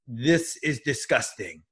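put-on slow, sheepish French-accented English; drawn-out French accent, caught in the act; imitating a French philosopher's accent, slowly. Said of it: "in English with a French accent".

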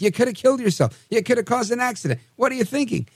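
high-pitched scolding, no real shout; mock yelling. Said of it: "to yell at the dog".

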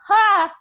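big ringing laugh. Shown as a demonstration. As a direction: laugh loud from the belly. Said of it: "a nice ringing laugh".

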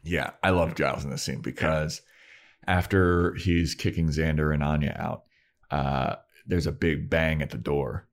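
normal talking voice

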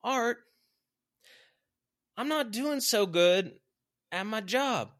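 very high, loud mock plea; complaining follower. Struggling worker asking Art for advice, high mock plea. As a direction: imitate someone bringing a problem to the boss. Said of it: "people coming up to me saying".

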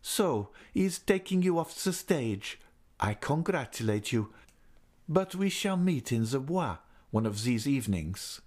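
bitter mocking lover; satirical. A narrator's reading. Raoul mocking Christine, bitter and sarcastic. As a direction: sneer the congratulations. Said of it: "still more satirically".

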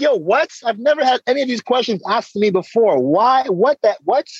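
high-pitched, baffled retort; incredulous protest. Retold or imitated. Driver taken aback by cops' questions, incredulous. Reacting to police questions just retold.

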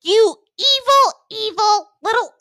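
high, loud outraged shriek; furious Carly. Acted. Carly accusing Neville, loud high outrage. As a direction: spit out the insult in outrage. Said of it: "Carly narrows her eyes ... and goes".

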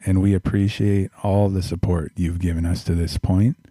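big loud ad read; announcer voice. Acted. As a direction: pitch the Patreon like a radio host. Patreon plug in announcer voice.

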